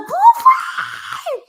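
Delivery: high, loud shouted greeting; excited shout. Real, not acted. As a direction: yell the name out in delight. Calling out a viewer's name.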